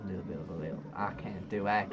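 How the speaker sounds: clumsy put-on southern accent. Shown as a demonstration. Copying friend's lilt, rough southern accent. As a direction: drag it out in an amateur southern accent. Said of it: "I don't do accents too well".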